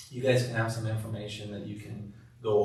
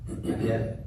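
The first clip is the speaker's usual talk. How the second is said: empathetic. Shown as a demonstration. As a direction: hand on heart, whisper it kindly. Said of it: "simple hand over your heart".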